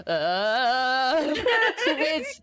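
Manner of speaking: cracking up mid-word; giggling through the word; laughing. Real, not acted.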